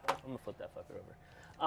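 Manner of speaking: soft muttered aside. Real, not acted. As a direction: mutter it to yourself.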